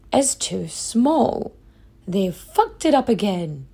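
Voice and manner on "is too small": very slow, dragged-out is too small; drawn-out letdown. Real, not acted. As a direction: stretch each word in disappointment. Second failure of the key.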